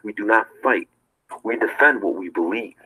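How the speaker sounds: ordinary delivery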